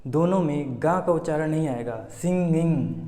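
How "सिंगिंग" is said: usual speaking voice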